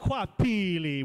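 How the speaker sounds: Hwapele said slowly, stressing each syllable; Hwapele pronounced. Shown as a demonstration. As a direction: draw out Hwapele for the audience to copy. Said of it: "which means?".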